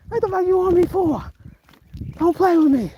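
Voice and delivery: rattled walker threatening a dog. Real, not acted. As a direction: warn the dog off hard. Confronting the dog.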